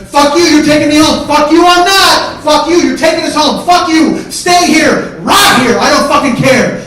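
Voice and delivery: high, full-volume screamed exchange; furious shouting match. Retold or imitated. Brothers yelling about the ride home, screaming furiously. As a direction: trade screamed insults without letting up.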